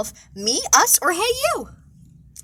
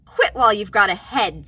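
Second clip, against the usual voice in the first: snapped; 5 semitones lower, 4 dB louder than usual. Acted. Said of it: "snapped Snide".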